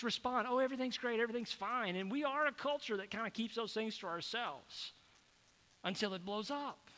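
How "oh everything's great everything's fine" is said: rote fake cheer. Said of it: "we've become so rote about how".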